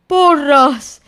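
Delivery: high, loud cry from inside a jar; muffled exclamation. A narrator's reading. Pooh stuck in the jar, muffled curse. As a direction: curse it, trapped in the honey jar. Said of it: "dijo desde dentro del tarro".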